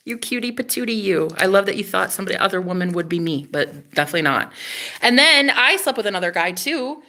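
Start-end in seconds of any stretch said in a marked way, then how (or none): none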